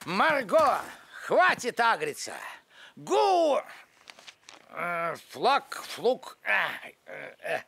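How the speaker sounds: fumbling new slang, trailing off; an old man mangling modern slang; awkward slang attempt. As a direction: try on youth slang and stumble. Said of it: "над речью тоже придётся поработать".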